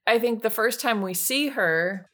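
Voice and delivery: ordinary talk, nothing marked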